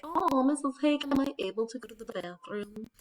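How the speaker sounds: mock an overly formal student request; student asking teacher. Student politely asking Missus Hake for the bathroom, put-on voice. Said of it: "we don't go around and be like".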